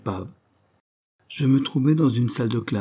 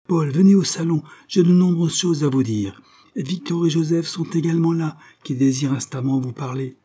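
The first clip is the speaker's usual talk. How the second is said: insistent summons; 6 semitones higher, 4 dB louder than usual. A narrator's reading. Madame Beck summons Paul.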